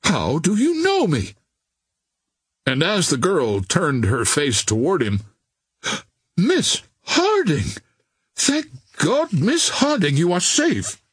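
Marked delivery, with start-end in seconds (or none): 0.0-1.4 high, startled question; astonished cry. A narrator's reading. Captain astonished the stranger knows him, crying out. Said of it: "he cried". 6.4-11.0 high, amazed cry of relief; joyful exclamation. A narrator's reading. Officer recognising the missing girl, exclaiming in relief.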